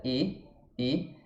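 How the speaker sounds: "E" with mouth widened sideways; closed unrounded "E"; spread Portuguese E. Shown as a demonstration. Teacher asking students to start here, spread E. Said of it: "além de eu abrir a boca lateralmente".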